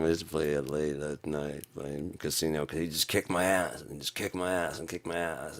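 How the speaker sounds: ordinary talk, nothing marked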